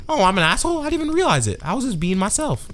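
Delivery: high-pitched feigned surprise; airy imitation of innocence; mock clueless innocence. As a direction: play the oblivious, conceited one. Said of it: "doesn't realize that she's an asshole".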